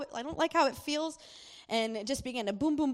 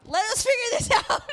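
much higher, louder laughing mock yell; mock shout; 12 semitones higher, 11 dB louder than usual. Parents yell at their son to let them sort it out, mock shout. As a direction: shout at the child in half-laughing fury. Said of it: "We're just sitting there like".

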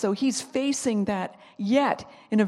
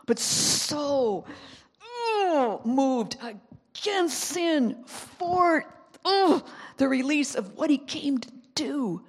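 groaning, drawn-out words, pitched higher; straining, deeply moved; 6 semitones higher, much slower than usual. Acted. Preacher showing how moved Jesus was, straining groans. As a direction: strain each word out as if birthing it. Acting out being moved in gut.

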